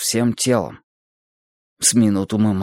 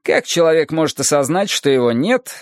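lightly voiced teacher, higher than the narration; Adonis expounding; 5 semitones higher than usual. Adonis explains the code of human simulation, raised lecturing voice. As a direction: expound it in a lifted, insistent lecturing voice.

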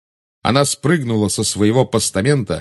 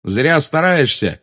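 shouting; 6 semitones higher, slower than usual. Acted. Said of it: "крикнул Гарри".

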